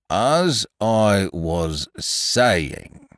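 very slow, stern donkey voice; slowly and sternly. Acted. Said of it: "loudly and sternly".

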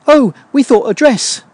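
high, slow put-on voice; female candidates. Girls on the team answering about outfits, high mock-female voice.

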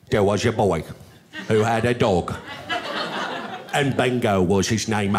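low Cockney Michael Caine. Acted. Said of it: "Michael Caine".

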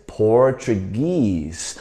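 Portuguese slowly, weight on the final "guese"; stress on last syllable. Shown as a demonstration. Teacher models Portuguese for the class, slow, end-stressed. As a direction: stretch Portuguese, stressing its ending. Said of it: "重音在后面哦".

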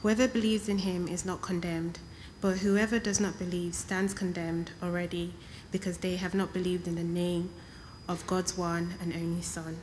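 normal talking voice

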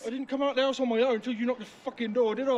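high-pitched, heated protest; real indignant outburst; indignant anger. Real, not acted. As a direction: protest angrily that they started it. Justifying himself after the fight.

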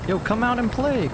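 mocking taunt. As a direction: tease them out of hiding. Taunting hiding enemy.

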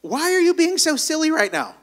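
high-pitched scolding bird; high, incredulous little sparrow; sparrow character. Acted. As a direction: chide in a high cartoon sparrow voice. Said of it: "the sparrow looks at me and goes".

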